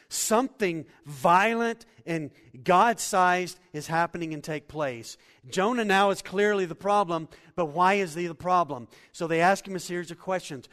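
loud, rising sermon delivery; full-force preaching. Acted. Pastor to congregation, building the storm scene, loud preaching. Preaches Proverbs and Jonah's questioning.

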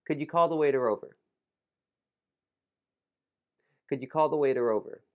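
"could you" blended into a quick "could ya"; reduced "could you". Shown as a demonstration. Teacher modelling a sentence to repeat, "could you" said as "could ya". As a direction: run "could you" together, brisk and connected. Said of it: "could you should be could ya".

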